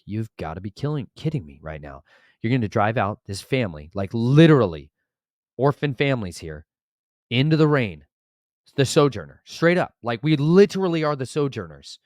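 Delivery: building from restrained to loud, high protest; retold outrage, punching the key words; indignant disbelief. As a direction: protest the injustice, rising as you go. Indignant retort about being sent out.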